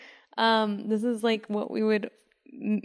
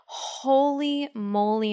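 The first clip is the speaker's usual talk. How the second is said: drawn-out exclamation; 4 semitones higher, 3 dB louder, much slower than usual. Real, not acted. Complaining about neck pain.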